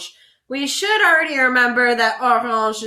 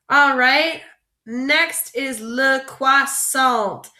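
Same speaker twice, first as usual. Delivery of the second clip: usual speaking voice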